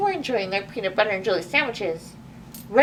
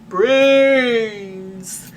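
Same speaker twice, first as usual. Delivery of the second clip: drawn-out louder zombie groan; zombie moan; 6 dB louder than usual. Acted. The zombie horde moans at little Abigail, drawn-out and louder. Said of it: "they moaned".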